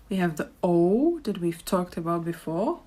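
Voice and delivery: ordinary talk, nothing marked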